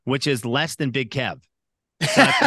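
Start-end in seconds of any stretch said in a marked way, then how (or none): 2.0-2.5 laughing, high and loud. Real, not acted. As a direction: break into a laugh. Speaker laughing proudly.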